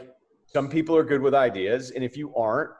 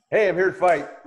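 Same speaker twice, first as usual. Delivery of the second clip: mock tough fighter; 6 semitones higher, 6 dB louder than usual. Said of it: "doesn't, like".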